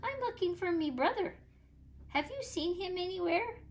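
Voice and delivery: high little leprechaun voice; tiny voice. A narrator's reading. Leprechaun brother asking Sidney, high and tiny. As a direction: ask sweetly and small. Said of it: "the tiny voice".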